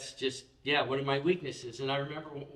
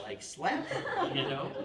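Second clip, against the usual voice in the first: laughing. Real, not acted. Pastor laughs recalling the student's remark. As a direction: laugh through the words. Laughing while telling story.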